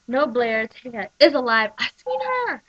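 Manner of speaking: loud, rising to a high cry; insistent shout. Retold or imitated. Todd insisting to Blair that Taya is alive, loud.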